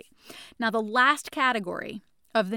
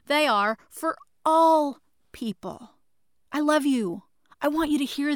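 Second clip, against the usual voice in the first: impassioned; 5 semitones higher, 5 dB louder than usual. Real, not acted. Impassioned plea to listeners.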